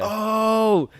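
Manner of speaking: loud, high-pitched "oh" of alarm; shocked cry. Retold or imitated. Husband hearing the water broke, loud shocked cry. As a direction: let out a big startled cry. Heart drops at news of labour.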